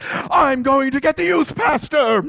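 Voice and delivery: high, slow mock-outraged leader; hammed-up threat breaking into a high wail; hysterical threat. Leader off to get the youth pastor, hysterical threat.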